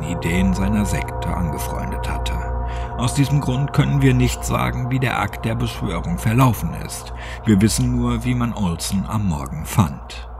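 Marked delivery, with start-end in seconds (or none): none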